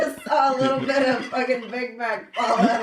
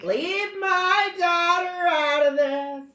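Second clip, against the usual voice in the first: slow, higher, deliberate delivery; quoting the correct line; 7 semitones higher, much slower than usual. Retold or imitated. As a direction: spell out the line slowly. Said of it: "she almost got it right".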